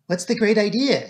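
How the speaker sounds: a touch of mirth, no real laugh; amused. A narrator's reading. Secretary greeting Poirot's plan, lightly amused. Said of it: "he said, laughing".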